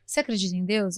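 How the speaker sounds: usual speaking voice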